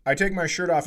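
ordinary delivery

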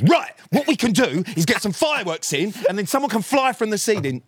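high, loud, gushing mock enthusiasm; overexcited pitch. Imitating Sam pitching fireworks at the meeting start, high giddy excitement. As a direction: sell the fireworks idea like a giddy kid. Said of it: "he says".

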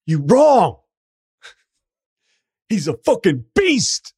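high, loud shout from across the room; heckling shout. Retold or imitated. Laker fan heckling the host on live radio, high loud shout. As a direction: yell it so it carries over the live broadcast. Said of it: "saying it so that it would hopefully, like, carry over the airwaves".

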